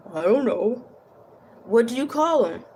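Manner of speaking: put-on puzzled question in a Russian accent; thick Russian accent. A narrator's reading. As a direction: play a Russian asking for the punchline. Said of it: "in his thick Russian accent".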